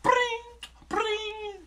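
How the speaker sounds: high trilled "brring"; imitated phone ring. His phone was going off.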